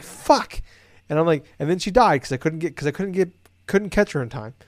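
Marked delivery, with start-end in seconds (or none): 0.0-0.6 sharp high curse; frustrated. Retold or imitated. Player after losing his cargo in the river, cursing. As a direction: spit out a mock angry curse.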